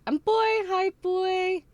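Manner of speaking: high, loud squeal of hello; excited greeting. Real, not acted. Streamer greets a viewer who just arrived, excited high shout. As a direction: call out in a big high voice.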